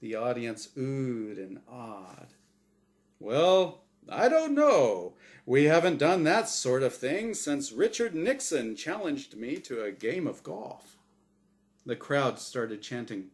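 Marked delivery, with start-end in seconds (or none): 3.2-11.0 booming, bright host voice; game show host. Acted. The angel Azrael hosting his show for Norm, big host voice. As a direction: sell it like a TV quiz host.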